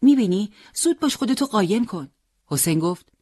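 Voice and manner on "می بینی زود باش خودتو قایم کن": high, urgent alarm; startled plea to hide now; surprised. Acted. Boy urges the fighter to hide, surprised and urgent. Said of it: "با تعجب گفت".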